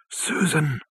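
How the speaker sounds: high, soft wailing cry; distant wail. A narrator's reading. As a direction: wail it softly, as if carried on the wind. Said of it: "a hoarse and distant wail".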